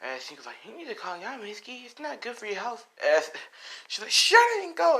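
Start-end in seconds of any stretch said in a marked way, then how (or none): 0.6-2.8 high, soft, timid voice; acted meek reassurance; shy girl. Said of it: "Inko kinda like that shy type". 4.1-5.0 high, loud acted snap; pitched-up mock yell; angry shout. Mitsuki yells at Inko.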